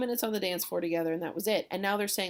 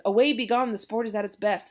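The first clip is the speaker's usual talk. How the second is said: loud, higher, hurrying call; acted rallying of friends to go; urgent command; 4 semitones higher, 8 dB louder than usual. Benvolio getting the boys out of the party, loud acted urging.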